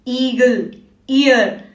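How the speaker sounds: ईगल, ईयर opening on a held "ee"; long E sound. Shown as a demonstration. Teacher giving long E examples, held "ee" in ईगल, ईयर. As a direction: lengthen the "ee" starting ईगल and ईयर. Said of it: "long sound of E आता है".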